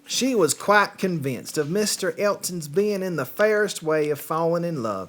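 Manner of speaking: put-on playful Southern accent on a British novel. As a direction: read it like a Southern storyteller. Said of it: "it's been a week since I've done a Southern accent".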